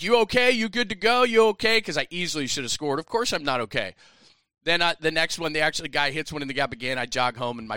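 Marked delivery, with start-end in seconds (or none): 0.0-1.8 high, loud rapid-fire check-in; teammates calling out. Retold or imitated. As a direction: imitate a team shouting their concern. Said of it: "everyone's like".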